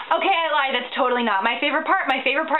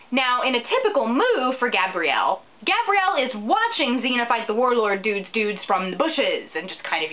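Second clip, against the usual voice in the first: usual speaking voice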